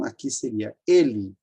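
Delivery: usual speaking voice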